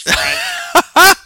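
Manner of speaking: high, loud exasperated cry. Real, not acted. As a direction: cry out the name in despair.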